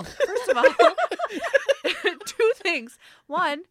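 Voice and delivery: high, loud, speech broken by laughter; real laughing fit. Real, not acted. Answering the pushy agent, helplessly laughing. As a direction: crack up while starting a list. Cracks up at her own reply.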